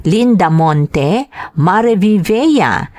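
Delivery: ordinary delivery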